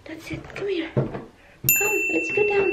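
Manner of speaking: very high, encouraging little voice. Real, not acted. Parent calling little man to come down, coaxing baby talk.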